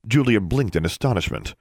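ordinary delivery, nothing marked

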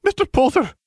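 stern teacher snapping the name; snapped. A narrator's reading. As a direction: snap out Mister Potter as a rebuke. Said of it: "she snapped".

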